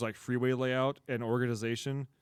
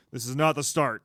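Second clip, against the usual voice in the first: ordinary talk, nothing marked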